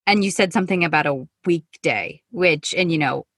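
ordinary delivery

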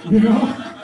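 high, breaking into laughter; real laughing. Real, not acted. Preacher amused they gave him the job, laughing. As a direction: laugh through the words.

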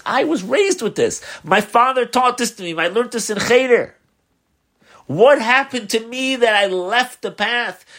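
high, heartfelt self-reproach; impassioned regret. Retold or imitated. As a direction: cry out in rising regret. Lapsed man's stirred regret retold.

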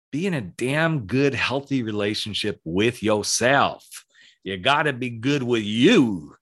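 drawled-out, folksy Southern accent; Southern drawl with stretched, swooping key words. Real, not acted. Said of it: "doing that Southern drawl".

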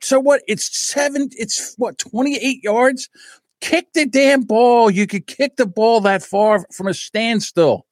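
high-pitched, agitated protest; exasperated sports fan. Acted. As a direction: rant at the player on screen. Exasperated reaction to the kicker's blunder.

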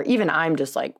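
usual speaking voice

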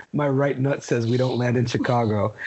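low-pitched, just-woken announcement; John Candy. Retold or imitated. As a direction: drop way down, as if half asleep. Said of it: "John Candy snoring in his ear".

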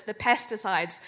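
ordinary talk, nothing marked

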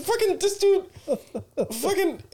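very high comic sputter; mock indignation. Contestant reacting to a rival lawyer, high mock indignation.

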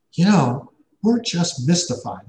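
a hint of a baffled office assistant; puzzled receptionist. Scheduler voiced in retold story.

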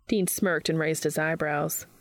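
ordinary delivery

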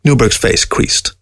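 plain reading voice

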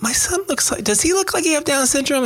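father anxiously asking the nurse about his newborn son, high and worried; anxious question. Retold or imitated. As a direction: ask the nurse nervously.

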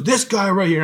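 high-pitched mock yell, little added volume; retold yelling. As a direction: imitate an irate squad leader starting to yell. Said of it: "he started yelling".

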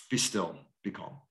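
low, soft and calm, hushing the storm; calm command. Retold or imitated. Jesus hushing the storm, low and calm. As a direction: still the storm with quiet authority.

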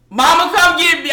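high, loud frightened cry for mom; begging her mother to come, panicked; desperate plea. Retold or imitated. Calling mother after beating.

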